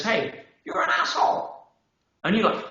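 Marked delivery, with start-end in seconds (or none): 0.0-1.6 high-pitched mock jab, tossed off; mock insult. Speaker imitates a stranger insulting you, high mock jab. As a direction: play the rude stranger, pitched way up. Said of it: "somebody turns around and says".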